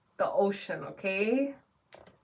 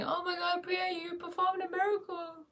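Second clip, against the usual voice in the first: mock excited scream; 10 semitones higher, 4 dB quieter than usual. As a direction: pretend gushing, pitched way up. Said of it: "come back to me screaming".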